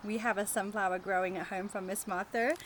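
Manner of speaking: usual speaking voice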